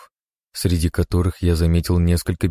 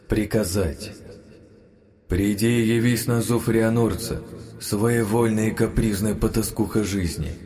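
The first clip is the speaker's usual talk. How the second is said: hushed incantation; 2 semitones higher, 5 dB quieter than usual. Acted. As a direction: murmur the spell like a ritual. Magic incantation. (тихое заклинание)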